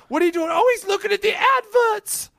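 high, mock British sketch voice; Monty Python voice. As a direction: pitch it up like a Python character. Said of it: "Monty Python skits that they go".

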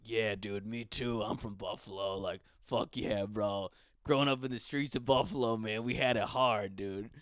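hint of a poser thug; mock wannabe rapper. Comic mocking local rappers, mild tough-guy voice. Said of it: "And I'm like, what?".

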